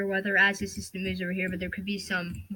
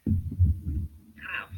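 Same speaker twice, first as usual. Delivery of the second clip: sudden low pained ow from a toothache; 9 semitones lower than usual. Real, not acted. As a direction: wince out a real toothache ow. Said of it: "that hurt".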